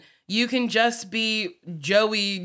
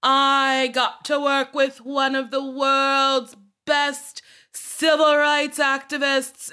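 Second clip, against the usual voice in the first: slow, higher, earnest Gaga announcement; [name] impression; 5 semitones higher, slower than usual. Lightly voiced Gaga, slow and higher, telling her concert crowd. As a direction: grandly announce it like a pop star. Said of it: "she was like".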